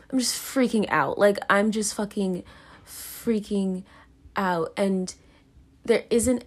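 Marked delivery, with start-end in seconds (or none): none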